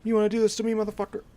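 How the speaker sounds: high furious yell at the enemy; angry shout. Real, not acted. Gamer yells at a cheating enemy, high and loud. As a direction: yell up at him, outraged.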